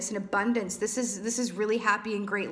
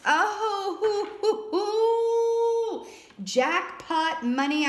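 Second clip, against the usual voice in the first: high, long-held hooting cheer; whoop, then stretch the words out gleefully; thrilled whoop; 10 semitones higher, 3 dB louder, much slower than usual. Real, not acted. Reader sees a winning card for Libras, thrilled.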